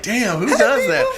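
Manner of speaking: high, slow amazed exclamation with a laugh. Retold or imitated. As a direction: marvel at it, laughing. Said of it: "I was like".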